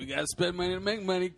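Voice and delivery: mocking. Mocking the other's line.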